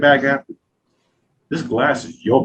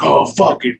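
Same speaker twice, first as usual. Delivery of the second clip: louder, higher mock exasperation; retold frustrated outburst; 4 semitones higher, 6 dB louder, slower than usual. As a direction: imitate someone giving up in frustration.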